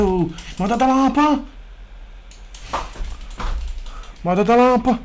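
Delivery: high, loud yell for the ball; exasperated shouting. Real, not acted. Gamer demanding the ball from teammates, exasperated shout.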